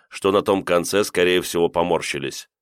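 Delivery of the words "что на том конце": plain reading voice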